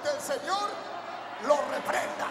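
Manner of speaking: booming call to the congregation; loud. Shown as a demonstration. Pastor urging church to proclaim, loud. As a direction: say it strong, lead them. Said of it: "diga bien fuerte".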